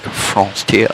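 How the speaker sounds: "Frontier" as nasal French "fron-tee-ah", rising; mock French accent. Shown as a demonstration. Giving in to chat's correction, "Frontier" in mock French accent. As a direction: put a playful French lilt on "Frontier". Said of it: "I have to be like".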